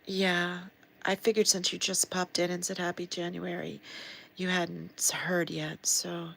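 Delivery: ordinary delivery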